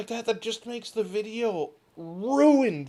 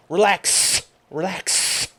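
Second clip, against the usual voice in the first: the word relax pushed out louder and louder; a youtuber overdoing relax into the mic, louder; loud mock ASMR relax; 8 dB louder, much slower than usual. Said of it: "And then we go".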